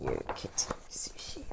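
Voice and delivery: soft fast whisper; a hushed aside to the cat; whispered praise. A narrator's reading. Narrator praises softly.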